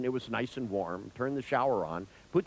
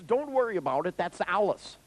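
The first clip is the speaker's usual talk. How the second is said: raised pitch, mildly called out; casual mock holler, higher; retold call from another room; 8 semitones higher than usual. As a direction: imitate calling through from next door. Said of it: "he yelled out from the other room".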